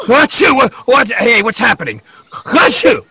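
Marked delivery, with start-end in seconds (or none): 0.1-0.5 loud sneeze. Acted. Pirate sneezing fit.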